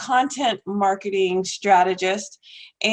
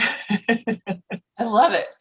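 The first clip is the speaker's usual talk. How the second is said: laughing; much slower than usual. Real, not acted. Host laughing at guest's story.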